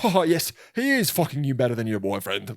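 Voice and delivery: hammed-up formal British voice; formal British notary. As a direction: play a prim British official. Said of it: "it feels more formal".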